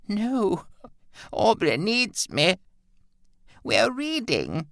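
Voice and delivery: high, slow, frightened protest; cling to the book in fear; terrified refusal. A narrator's reading. Mother refusing Wilfred, high and scared. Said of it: "in what looked like terror".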